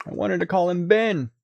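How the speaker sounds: put-on high whiny child pleading; whiny kid voice. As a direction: play a sulky kid begging mom. Mock child pleading with mom.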